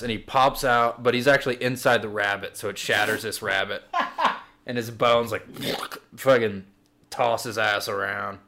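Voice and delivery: ordinary delivery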